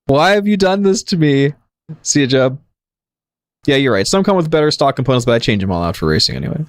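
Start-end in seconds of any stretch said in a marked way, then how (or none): 0.0-2.6 high-pitched mock complaint; mock protest. Real, not acted. Streamer to viewer who sent the meme, mock protest. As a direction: plead in mock outrage, pitched up. Reacting to absurd meme clip.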